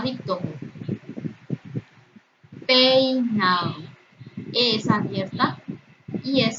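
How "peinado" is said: "Peinado" stretched slowly; drawn-out example word. Shown as a demonstration. Teacher presenting a word for diphthong analysis, drawn out. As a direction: draw out "Peinado" at half speed for learners.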